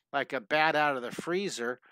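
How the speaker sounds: usual speaking voice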